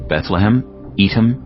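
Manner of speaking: ordinary delivery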